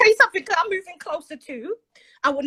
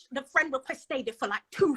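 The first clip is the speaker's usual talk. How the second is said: ordinary talk, nothing marked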